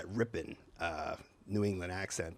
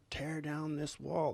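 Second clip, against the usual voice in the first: slow, raised presidential oratory; imitated statesman's proclamation, softer but slow; Ronald Reagan; 5 semitones higher, 5 dB quieter, slower than usual. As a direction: declaim it like a speech-maker, drawn out. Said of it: "Ronald Reagan tried to copy that".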